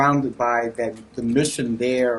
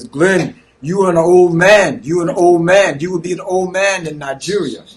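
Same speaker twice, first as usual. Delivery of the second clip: loud, raised teasing imitation; mock-emphatic teasing, higher pitched; kidding; 5 semitones higher, 8 dB louder than usual. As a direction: imitate a friend ribbing you, loudly. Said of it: "used to always kid me, he says".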